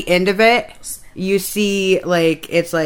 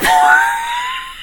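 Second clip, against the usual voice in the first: shrieking laugh. Real, not acted. Laughs about unknown word.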